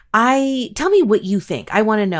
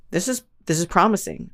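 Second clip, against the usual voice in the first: ordinary talk, nothing marked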